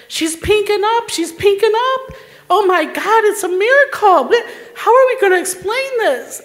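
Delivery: high, loud, astonished exclaiming; amazed excitement. Retold or imitated. Nurse sees the patient revive, amazed excitement. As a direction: exclaim it in rising disbelief.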